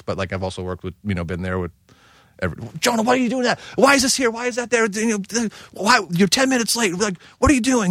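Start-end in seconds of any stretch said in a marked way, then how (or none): 2.8-7.9 angry chef yelling. Retold or imitated. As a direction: chew out a late cook. Yelling boss chef quoted.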